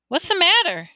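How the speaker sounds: high, squeaky-light question; whispered question. A narrator's reading. Woman in the elevator to the boy, nosy question. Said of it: "she whispered".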